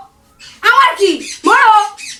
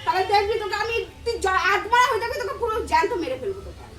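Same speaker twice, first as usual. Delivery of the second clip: much softer, fading menace; quiet threat; 11 dB quieter than usual. Real, not acted. Wife threatens in low voice.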